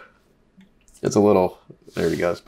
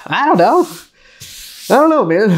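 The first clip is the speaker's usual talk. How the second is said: much higher, silly unsure squeak; squeaky shrug; 12 semitones higher than usual. Acted. Asked if the pickle beer is good, a high silly shrug.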